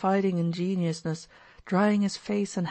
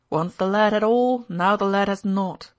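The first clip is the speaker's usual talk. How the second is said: sudden cry; 3 semitones higher, 7 dB louder than usual. A narrator's reading. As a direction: fling out the verse. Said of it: "cried Mitya suddenly".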